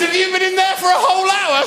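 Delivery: high, loud disbelieving shout; shocked question. Retold or imitated. Teacher to locked-in pupil, high alarmed shout. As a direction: ask it aghast. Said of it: "I said".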